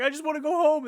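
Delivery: high-pitched put-on whine; hammed-up desperate plea; mock panicked pleading. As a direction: imitate someone begging to escape. Voices character begging to leave.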